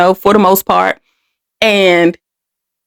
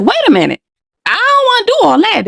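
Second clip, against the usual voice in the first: put-on higher, startled childlike refusal; a scared kid backing out, voice jumping up; retold young protest; 11 semitones higher, faster than usual. Girl telling the man she wants out, with a high startled protest. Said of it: "So I'm like".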